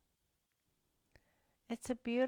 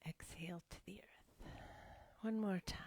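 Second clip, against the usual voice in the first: breathy whisper, no voice at all; whispered cues; 7 dB quieter than usual. Acted. Teacher whispering the exhale to the class, hushed whisper. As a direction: whisper the cue so the room stays still.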